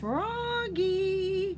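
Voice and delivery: high, loud drawn-out call. A narrator's reading. Mother calling Froggy back inside, high and loud. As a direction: call him in from far away. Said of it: "called his mother".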